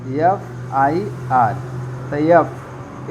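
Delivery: F I R spelled very slowly; slow spelling. Shown as a demonstration. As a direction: spell each letter for the class. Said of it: "ए एफ लिखेंगे".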